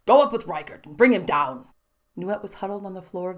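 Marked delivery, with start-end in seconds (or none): 0.1-1.7 louder clipped command; officer's order. A narrator's reading. Officer sends guards to search the building, commanding.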